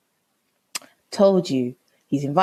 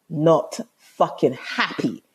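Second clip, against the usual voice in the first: each word drawn out and weighted; slow, emphatic displeasure; much slower than usual. Retold or imitated. Girlfriend to boyfriend at barbecue, slow emphatic displeasure. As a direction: repeat it slowly so it sinks in. Retold row, repeats for emphasis.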